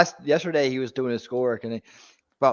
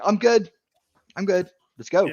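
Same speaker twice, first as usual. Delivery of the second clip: imitating a kid, higher-pitched and eager; mock a child bouncing back, raring to go; high, chipper retold kid voice; perky recovered kid; 8 semitones higher than usual. Imitates son waking up recovered.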